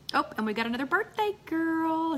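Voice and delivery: high, bright excited greeting; light up at the news. Real, not acted. Spots birthday guest arriving.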